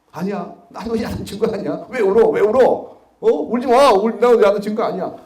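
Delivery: a loud, urgent don't-cry reassurance. Retold or imitated. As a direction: call out to calm the crying kid. Prosecutor consoling crying boy.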